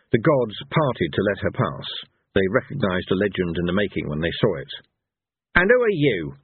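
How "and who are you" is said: high, angry demand; snapping with rage. A narrator's reading. Cohen challenges the unknown lady, snapping with rage. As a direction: snap the question out, pitch raised. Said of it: "snapped Cohen, still red with rage".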